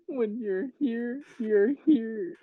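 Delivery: giggling through the words, slow and high; laughing. Retold or imitated. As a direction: laugh while echoing the slogan. Mimicking the announcer line, laughing.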